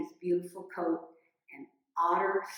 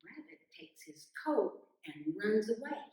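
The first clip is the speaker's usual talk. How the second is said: ordinary delivery, nothing marked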